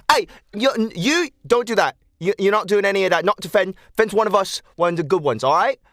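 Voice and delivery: high, loud telling-off; scolding. Acted. Snow scolding the child who was rude to Fen, high and loud. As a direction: lay down the law to a cheeky kid.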